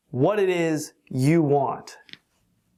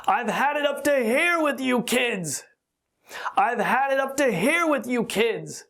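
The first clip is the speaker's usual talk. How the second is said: higher-pitched mock exasperated father; imitating angry father; 7 semitones higher than usual. Father scolding his noisy kids, mock exasperated. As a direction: play the fed-up dad at his noisy kids. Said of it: "Like my father would say".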